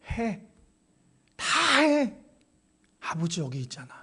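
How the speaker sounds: quiet, falling, intimate promise; gentle closing words from the pulpit; soft and hushed. Acted. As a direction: drop to a near hush and comfort them. Same words, quietly to close.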